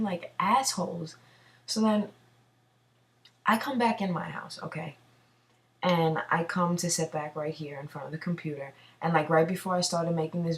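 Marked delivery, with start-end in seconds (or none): none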